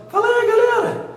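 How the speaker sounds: very high, loud falsetto greeting. Shown as a demonstration. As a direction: shoot the voice way up into a shrill falsetto. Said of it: "falar com a voz do [name]".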